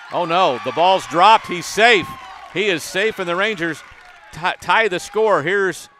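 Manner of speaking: rising to a shouting call as he scores; excited play-by-play. Real, not acted. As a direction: build to a roaring home-run-style call. Live call of the tying run.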